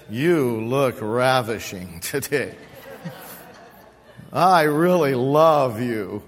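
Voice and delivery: low, slow lover's croon, hammed up; mock romantic. Pastor pretends to woo his microphone, mock romantic. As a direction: woo it in a deep, smitten voice, as a joke.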